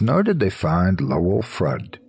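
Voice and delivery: ordinary delivery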